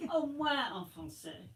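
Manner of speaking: prim teacher French. Acted. Teacher correcting student.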